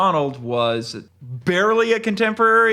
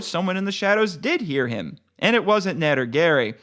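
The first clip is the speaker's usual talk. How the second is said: usual speaking voice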